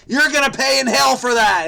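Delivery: loud, high, condemning preacher voice; mock hellfire preacher. Mock-scolding the solver for sleeping in, loud and high. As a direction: thunder the damnation like a mock preacher. Mock moral judgment over sloth.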